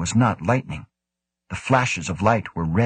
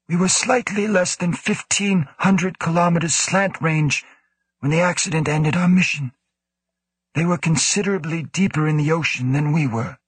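reporting director; 9 semitones higher than usual. A narrator's reading. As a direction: report it higher and firmer. Woe reports the mission.

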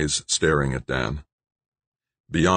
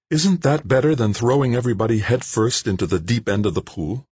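higher woman's voice, arguing; Jane arguing; 7 semitones higher than usual. Acted. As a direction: push back on him as Jane. Jane argues.

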